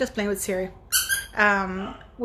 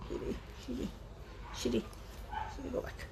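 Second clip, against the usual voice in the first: hushed, voiceless whisper; quick private command in a real whisper; whispered aside; 17 dB quieter than usual. Real, not acted. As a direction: whisper it under your breath, much softer. Aside to a voice assistant.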